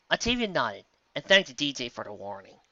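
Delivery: plain reading voice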